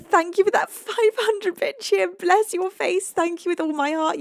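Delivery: high, gushing thanks; delighted gratitude. Real, not acted. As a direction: gush at a generous supporter, pitch lifted. Thanks viewer for big donation.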